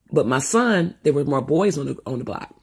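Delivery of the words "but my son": usual speaking voice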